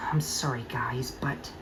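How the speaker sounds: whispered, soft and sorry; sad whisper. Acted. Thomas whispers sadly to friends, sad whisper. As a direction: breathe the apology out.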